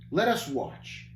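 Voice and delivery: ordinary delivery, nothing marked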